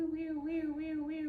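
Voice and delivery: high, soft wee-wee siren; siren imitation. Friend telling a story imitates the police siren behind them. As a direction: mimic a police siren in the mirror.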